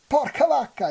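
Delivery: high, loud burst of amazement; excited exclamation. A narrator's reading. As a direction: blurt it out, thrilled by the catch. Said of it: "esclamò Odino". (esclamazione alta e forte, entusiasta)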